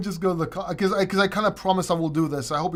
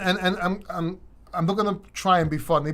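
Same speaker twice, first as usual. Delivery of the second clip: ordinary talk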